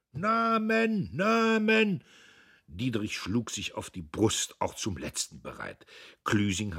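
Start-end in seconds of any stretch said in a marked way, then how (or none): 0.1-2.0 high, insistent call; high demanding cry; crowd demanding. A narrator's reading. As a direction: shout for names from the hall.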